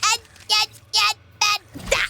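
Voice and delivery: high, loud shouts at the crab; scolding shout. Real, not acted. Streamer yelling at a crab enemy in game, scolding. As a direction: shoo the pest off, yelling.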